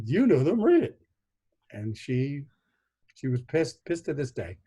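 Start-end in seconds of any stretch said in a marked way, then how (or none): 0.0-1.0 rising high and louder to the end; teasing reply. Retold or imitated. Son answering his puzzled aunt at the tombstone, teasing. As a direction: push it back at her with glee. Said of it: "I said".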